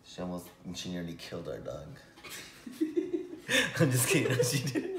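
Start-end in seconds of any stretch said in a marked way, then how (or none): 2.2-3.7 airy burst of laughter; breathy high giggle. Real, not acted. Teasing story about the dog. 3.8-5.0 louder, laughing through the words. Real, not acted. Laughing at her own joke.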